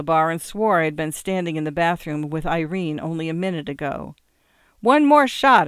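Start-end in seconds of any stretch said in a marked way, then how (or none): 4.8-5.7 loud, high-pitched call to the bartender; yelling. A narrator's reading. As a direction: yell your order across the bar. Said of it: "I yelled at the bartender".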